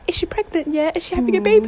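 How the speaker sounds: high, eager questions; grandma eager about a baby. Retold or imitated. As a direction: ask it like a thrilled grandma. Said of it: "she goes".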